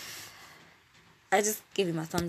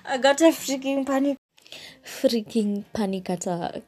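loud, higher-pitched exclamation; worked-up disbelief; 7 semitones higher, 6 dB louder than usual. Real, not acted. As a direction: blurt it out, incredulous at yourself. Exclaiming over own panic attack.

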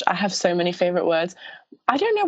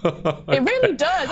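words through a laugh; 4 semitones higher, slower than usual. Real, not acted. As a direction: laugh while you say it. Laughing about hating moist.

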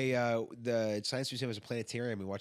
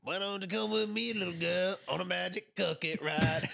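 higher, lightly put-on rock singer; singer impression; 8 semitones higher than usual. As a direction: croon it like a classic rock singer. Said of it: "I do a good impression of him".

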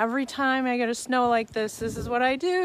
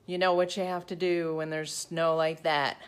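normal talking voice

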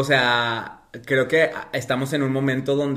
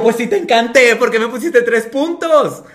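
indignant protest; 9 semitones higher, 8 dB louder than usual. Retold or imitated. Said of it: "Y yo".

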